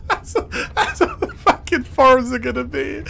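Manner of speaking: high, loud laughing words; helpless laughter. Real, not acted. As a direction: force it out while cracking up. Cracking up at own impression.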